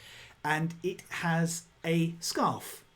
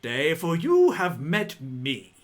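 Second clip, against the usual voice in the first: louder grand noble boast; pompous walrus lord; 3 semitones higher, 7 dB louder than usual. Acted. Walrus boasting to visitors, loud and grand. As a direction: proclaim it with self-importance.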